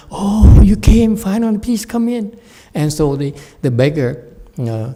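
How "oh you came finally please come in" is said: much higher and louder, gushing with delight; eager welcome. Acted. Abbot eagerly welcoming the awaited guest, high and loud. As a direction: throw the door open for the guest you've waited for.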